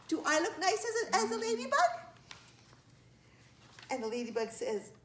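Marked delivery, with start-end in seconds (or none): 0.1-1.9 very high, cute storybook voice; eager questioning. A narrator's reading. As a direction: ask brightly with a rising end. Child playfully asks ladybug.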